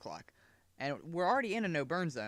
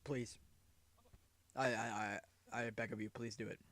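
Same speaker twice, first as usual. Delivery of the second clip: fading, softer pleading; mock begging; 3 semitones lower, 8 dB quieter than usual. Real, not acted. To viewers about subscribing, fading mock plea. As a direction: beg them quietly, trailing off.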